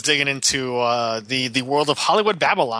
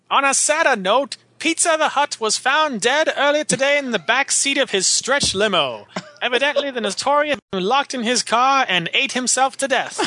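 high, mock-serious newsreader; news anchor; 10 semitones higher than usual. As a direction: read it like a deadpan TV anchor. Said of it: "it's even newsy".

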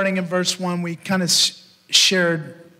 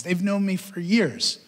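ordinary talk

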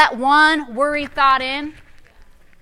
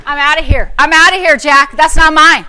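louder, declaring it's not yours; loud rebuke; 8 dB louder than usual. Shown as a demonstration. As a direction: shout the refusal out loud. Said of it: "that's what you have to say out loud".

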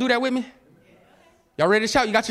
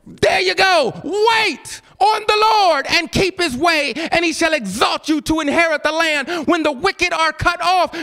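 preaching at full force; 6 semitones higher, slower than usual. Acted. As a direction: proclaim each verse like a preacher. Shouted scripture reading.

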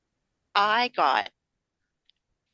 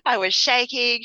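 mock shaky daughter; 6 semitones higher, 5 dB louder than usual. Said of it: "She gets it from me".

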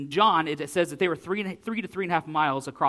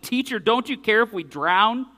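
higher, louder desperate cry; panicked plea; 6 semitones higher, 6 dB louder than usual. Retold or imitated. Disciples crying to sleeping Jesus in the squall, panicked. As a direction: wake him in panic over the storm.

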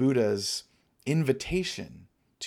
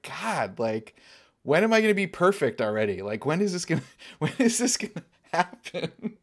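laughing at herself; 5 semitones higher than usual. Retold or imitated. Mocking her own impatience, laughing.

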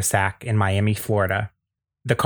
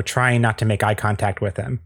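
ordinary talk

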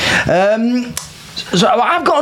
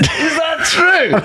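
high, drawn-out shocked question; real incredulous outburst; laughing disbelief; 13 semitones higher, much slower than usual. Real, not acted. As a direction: cry out slowly through a laugh. Shocked at hearing of the underpayment.

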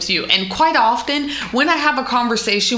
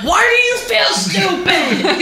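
drawn-out, high-pitched imitation yell; mock yelling; 9 semitones higher, slower than usual. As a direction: holler it slowly, as an exaggerated impression. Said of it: "You start yelling".

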